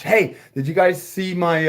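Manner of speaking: ordinary talk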